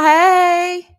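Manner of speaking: high, loud called-out hey. Real, not acted. Host calling hello to her viewers, high and loud. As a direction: sing it out high to the whole room.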